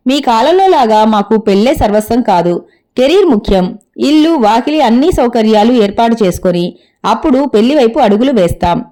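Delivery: usual speaking voice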